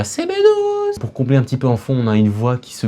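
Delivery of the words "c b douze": very high, stretched mock call; little cry. Shown as a demonstration. Vlogger showing which sample he used, high drawn-out cry. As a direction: imitate your own high order cry. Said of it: "mon petit cri".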